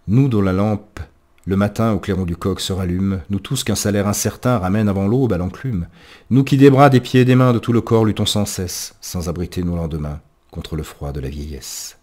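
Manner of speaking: declaimed workers' verse, rising then falling away; poem recitation. Acted.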